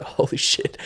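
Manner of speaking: breathless whisper of shock. Retold or imitated. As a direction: whisper it, caught off guard. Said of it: "And I was like".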